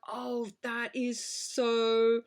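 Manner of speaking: very slow, stretched-out awe; drawn-out amazement. Retold or imitated. Podcaster replaying a viewer's awe at the reveal, amazed. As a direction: savour the amazement, stretching every word.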